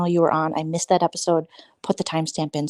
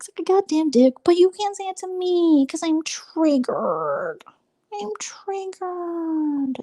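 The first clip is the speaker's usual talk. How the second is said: mock triggered whine; 12 semitones higher than usual. Mocking the ladies claiming to be triggered, whiny. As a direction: whine like a fake victim.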